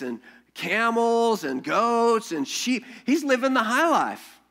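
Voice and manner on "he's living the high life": mock-excited. Mocking Abraham's riches.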